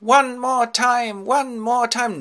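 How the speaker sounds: very high, slow, loud begging; nymph's plea. Acted. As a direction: draw it out in a high female caricature.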